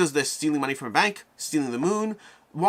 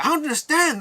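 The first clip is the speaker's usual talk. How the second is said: high-pitched mock bewilderment; bewildered crowd member; 13 semitones higher, 4 dB louder than usual. Someone in the crowd at the evil contest, high mock bewilderment. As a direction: cry it out in hammed-up confusion. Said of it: "someone else in the crowd's like".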